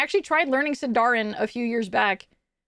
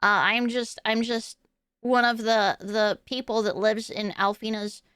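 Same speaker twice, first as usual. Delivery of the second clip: Ditzy; 2 semitones lower, 3 dB quieter than usual. Pretend. Nerd from the basement greeting the stream, awkward and soft. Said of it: "after I bring out Ditzy Nerd".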